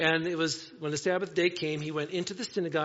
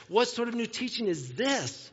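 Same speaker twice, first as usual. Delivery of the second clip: higher-pitched, mildly excited question; excited asking; 5 semitones higher than usual. A narrator's reading. Amazed audience discussing the new teaching, excitedly asking. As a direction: read it with a touch of amazed excitement. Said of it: "they asked excitedly".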